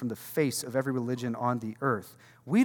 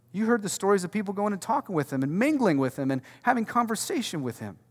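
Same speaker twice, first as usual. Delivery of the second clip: ordinary delivery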